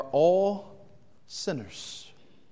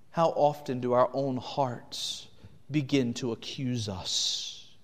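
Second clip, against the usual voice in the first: normal talking voice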